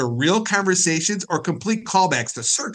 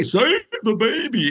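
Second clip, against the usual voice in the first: mock rescue plea in a raised voice; Frosty Little; 6 semitones higher than usual. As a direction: call it out like an old circus gag. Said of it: "when you do Frosty Little".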